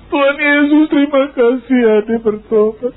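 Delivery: high, far-off voice of the wife, thanking God; wife's joyful praise. Retold or imitated. Wife hugging her husband, thanking Jesus in a high joyful voice. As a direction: squeal it like his overjoyed wife.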